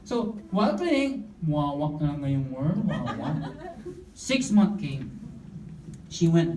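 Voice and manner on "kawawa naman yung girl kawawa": low, slow nasal murmur of "wawa"; mumbled nasal pity. Retold or imitated. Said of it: "ngongo din". (mahinang ngongong "wawa")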